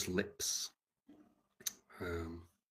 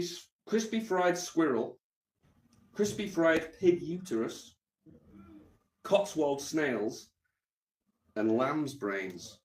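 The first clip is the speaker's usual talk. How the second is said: slow disbelief; 4 semitones higher, slower than usual. Real, not acted. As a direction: dwell on each item slowly. Repeating menu items.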